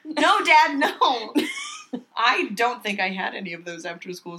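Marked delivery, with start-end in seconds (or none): 0.0-1.3 mock scold. Mock scolding imagined dad.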